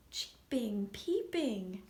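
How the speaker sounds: usual speaking voice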